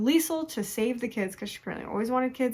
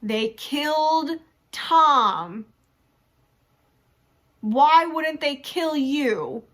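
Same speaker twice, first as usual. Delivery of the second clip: higher, louder and slow, pleading; urgent raised plea; 5 semitones higher, 5 dB louder, much slower than usual. Retold or imitated. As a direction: spell it out to him, high and hard. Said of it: "And Heather's like".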